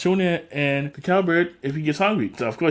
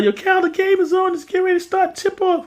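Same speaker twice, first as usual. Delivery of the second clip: calling out; 13 semitones higher than usual. Retold or imitated. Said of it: "Keenan yells to Kel".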